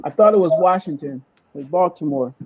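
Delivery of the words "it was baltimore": normal talking voice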